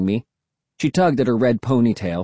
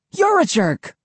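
shouting back; slower than usual. A narrator's reading. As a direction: shout it right back at him. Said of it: "Cassie shouted back".

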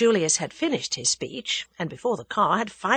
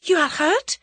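anxious quick question; 11 semitones higher, 5 dB louder than usual. A narrator's reading. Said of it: "said Annette quickly".

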